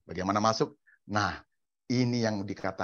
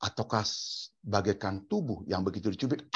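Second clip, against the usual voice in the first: ordinary talk, nothing marked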